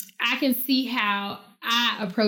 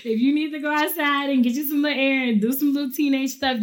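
a higher, half-teasing parent telling her off; raised-pitch parent lecture; 4 semitones higher than usual. Retold or imitated. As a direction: scold lightly, amused. Parent addressing daughter.

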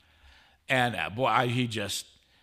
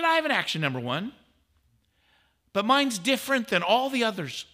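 pitched well higher, a naive collector showing off his comic; mock proud collector; 11 semitones higher, 4 dB louder than usual. Priest mocks a fake-comic owner, pitched higher, mock proud.

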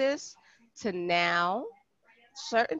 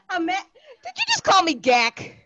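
mock outrage; 8 semitones higher, 6 dB louder than usual. Real, not acted. Outraged at being called Gak.

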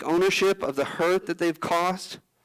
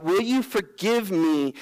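slow, earnest plea; 3 semitones higher, slower than usual. Shown as a demonstration. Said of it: "is for wrongdoing".

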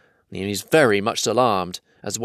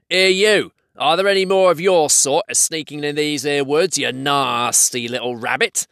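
raised, accusing troll voice; menacing troll; 5 semitones higher than usual. Acted. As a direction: interrogate a captive as a troll. Troll interrogates captured hobbit.

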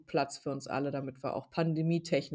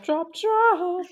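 squeaky high; 13 semitones higher, 8 dB louder than usual. Real, not acted. Playful echo of drop.

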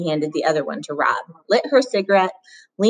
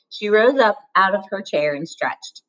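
plain reading voice

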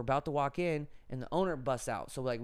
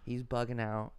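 usual speaking voice

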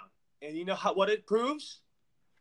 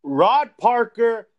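loud, slow, drawn-out call-out; unleashing; 13 dB louder, much slower than usual. Real, not acted. Podcast host unleashes on Rob Parker, loud angry call-out. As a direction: hit the name hard like a challenge. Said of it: "Go ahead, [name]. Unleash".

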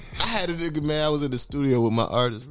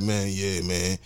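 lower, deflated mumble of a stranded friend; mopey friend; 9 semitones lower, 4 dB quieter, slower than usual. Retold or imitated. Host imitates a kicked-out friend asking for a ride, lower and deflated. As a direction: sound beaten and resigned. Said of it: "He's like".

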